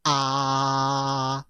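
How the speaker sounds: open-mouthed "Ah", held long and level; a steady, sustained "Ah" as for a dentist; first tone. Shown as a demonstration. Going to a dentist, open-mouthed level "Ah". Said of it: "you open your mouth".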